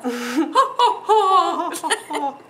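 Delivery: loud high burst of laughter. Real, not acted. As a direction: crack up out loud.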